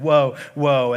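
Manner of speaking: loud mock wail of "Woe, woe". Philistines wail loudly in their tent, a mock lament. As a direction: wail it like the beaten army. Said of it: "So they just said".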